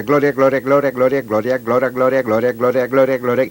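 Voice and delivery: quick repetitive gloria, lightly imitating the girl; speeding up repetition. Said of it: "aumentando el número de veces y la velocidad".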